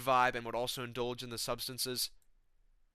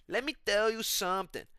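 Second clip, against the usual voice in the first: Renato Moicano; 8 semitones higher, 4 dB louder than usual. Shown as a demonstration.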